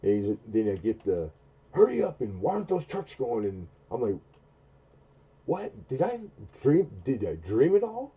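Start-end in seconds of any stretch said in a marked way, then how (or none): 1.7-3.5 barking boss. Retold or imitated. Boss voiced in story. 5.5-8.1 high, incredulous "What?"; mock disbelief. His own reply retold.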